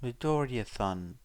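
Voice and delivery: plain reading voice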